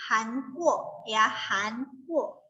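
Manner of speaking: Hankuo drawn out slowly, each syllable clear; slow teacher model of "Hankuo" with a rising "kuo"; careful pronunciation. Shown as a demonstration. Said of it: "Itu bacanya".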